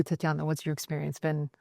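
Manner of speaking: ordinary delivery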